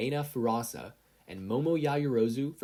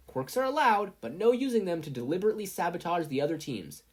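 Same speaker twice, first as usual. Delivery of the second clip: higher, louder, commanding referee voice; Midnight announcing; 10 semitones higher, 5 dB louder than usual. A narrator's reading. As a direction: referee starting the cavalry battle. Midnight starting the race.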